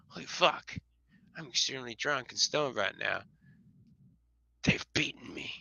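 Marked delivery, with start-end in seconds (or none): 4.6-5.5 low whispered defeat. Real, not acted. Admits defeat, drunk.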